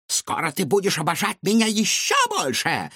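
high, gloating mad scientist. A narrator's reading. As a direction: boast with manic pride. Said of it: "сказал доктор Финдершлотц".

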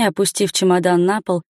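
ordinary delivery, nothing marked

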